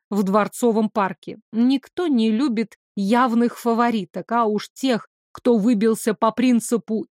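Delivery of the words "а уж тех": ordinary delivery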